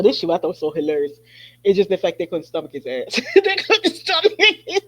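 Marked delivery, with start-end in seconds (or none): 3.3-4.8 said through laughter, higher and louder; laughing. Real, not acted. Amused host retelling gossip to listeners, laughing. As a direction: crack up while repeating it. Repeats the line while laughing.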